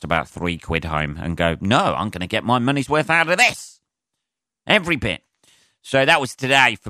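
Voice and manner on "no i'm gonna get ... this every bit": mock indignant. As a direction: build to an outraged high finish. Acted greedy diner insisting on value.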